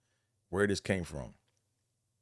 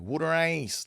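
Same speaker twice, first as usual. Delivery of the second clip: "Water ice" higher, Philly "wooder"; Philly accent; 8 semitones higher, slower than usual. Retold or imitated. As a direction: sell it like a Philly vendor. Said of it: "They be like".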